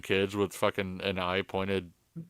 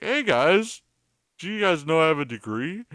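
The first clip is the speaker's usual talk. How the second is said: high, loud, showy bragging; 8 semitones higher, 4 dB louder than usual. Retold or imitated. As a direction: play a smug show-off. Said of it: "I'm not always trying to be like".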